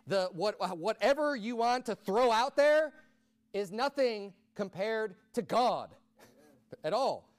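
loud, slow, rising preacher cadence; preaching at full force. Acted. Preacher tells the congregation nothing outmatches God, loud and slow. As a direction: build it like a sermon climax. Building sermon climax.

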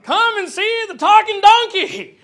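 loud, high, drawn-out barker cry; showman's call. Acted. Preacher hawking the donkey for money, as a showman. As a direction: announce a sideshow attraction to the crowd.

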